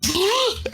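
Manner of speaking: sharp high gasp of terror; frightened gasp. Retold or imitated. Friends see a dark figure rushing them, sharp gasp. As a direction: gasp as a dark shape rushes you. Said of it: "we were both just like".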